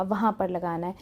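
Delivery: ordinary talk, nothing marked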